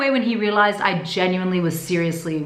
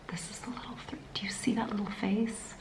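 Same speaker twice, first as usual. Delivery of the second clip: hushed whisper over a photo; 15 dB quieter than usual. Real, not acted. Showing viewers the baby scan, awed whisper. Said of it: "whisper over scan photo".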